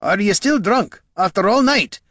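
appalled outburst. A narrator's reading. Dwarf finding the ranger still drunk, appalled. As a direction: demand it in disbelief.

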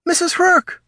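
high loud call after her. A narrator's reading. Auburn calling after fleeing gnoll, loud call. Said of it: "called Auburn".

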